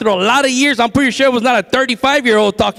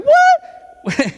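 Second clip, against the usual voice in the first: sudden very high astonished "What?"; amazed. Pretend. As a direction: Christ struck with amazement, pitch leaping up. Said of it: "Imagine Christ like".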